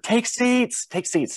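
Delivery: very high-pitched mock yell; trainees passing the word. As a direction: imitate recruits relaying an order in a high strained call. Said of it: "everyone's passing the word".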